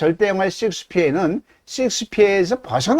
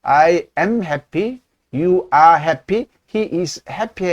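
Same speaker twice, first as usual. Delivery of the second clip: slow drill; much slower than usual. Shown as a demonstration. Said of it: "그래서 be happy 그래야 돼요".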